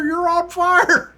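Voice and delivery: very high, slowed, laughing repeat; high squeaky voice, drawn out and breaking into laughter; laughing rookie. Retold or imitated. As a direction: stretch it out while cracking up. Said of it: "He's like".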